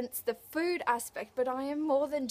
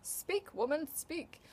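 mock command to herself; 3 semitones higher, 5 dB quieter, slower than usual. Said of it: "I was like".

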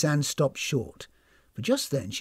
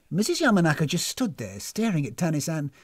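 usual speaking voice

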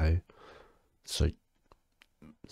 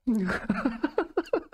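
high, helpless real laughter; giggling fit. Real, not acted. Cannot stop laughing.

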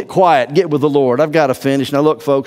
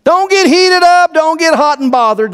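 high, rising, louder urging; excited preaching; 12 semitones higher, 4 dB louder than usual. Real, not acted. Pastor presses church not to get heated, pitch soaring. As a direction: push it up to a near shout. Preaching climax, laughing then shouting.

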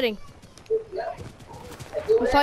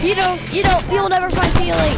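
faking madness; 5 semitones higher, 4 dB louder than usual. Acted. Mock taunt in a silly voice.

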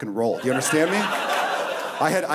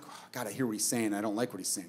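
soft, lower aside to oneself; muttered inner dismay; 6 semitones lower, 10 dB quieter than usual. Retold or imitated. Comedian retelling his thoughts on overhearing slurs, quiet dismay. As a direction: mutter it under your breath, reluctantly.

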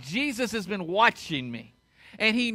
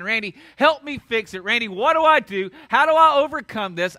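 rising, louder pleading voice; pleading plea; 3 semitones higher, 7 dB louder than usual. Retold or imitated. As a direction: ask for help in growing desperation. Congregant pleading with pastor.

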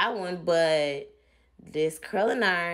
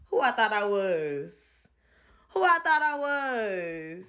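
laughing at herself; 3 semitones higher than usual. Real, not acted. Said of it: "laughs at herself".

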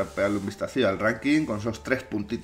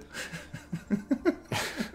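airy breathless laugh; 12 semitones higher, 10 dB quieter than usual. Real, not acted. Laughing at the lookalike.

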